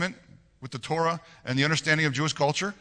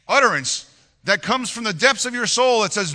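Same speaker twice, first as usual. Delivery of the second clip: usual speaking voice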